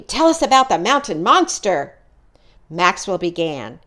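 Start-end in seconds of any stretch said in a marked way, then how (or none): 0.0-2.0 a higher, louder plea for a story; eager begging. Acted. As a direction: beg for the scary tale like excited kids.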